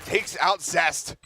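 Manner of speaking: high, loud call, then slowing; excited caster call. Real, not acted. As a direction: hype the kill, draw out the last words. Caster calling a kill.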